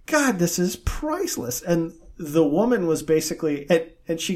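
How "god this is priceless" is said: amused exclaim. Retold or imitated. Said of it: "he goes".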